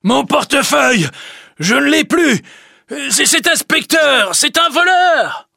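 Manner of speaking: high, loud shouts of alarm; man crying out in panic he has been robbed; panicked cry. Acted. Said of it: "Il tâte sa veste et crie".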